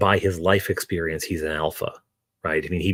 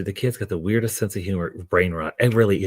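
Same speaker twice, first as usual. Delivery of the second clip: ordinary talk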